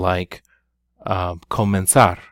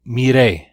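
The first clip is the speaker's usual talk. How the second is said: "mire" with the final e punched louder; loud, drawn-out stress on the end of "mire"; stress on last syllable; 4 semitones higher, 9 dB louder than usual. Shown as a demonstration. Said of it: "important that you stress that last syllable".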